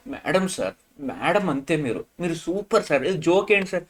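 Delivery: softened, fawning imitation of a flatterer; butter them up in a small, quiet voice; mock fan boy. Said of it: "ఏంటిది ఈ fan boy క్వశ్చన్లు".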